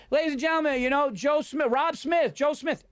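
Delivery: high, carrying mock host introduction; mock stage announcer. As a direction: call up the next comic like an MC. Acts out host introducing a comic.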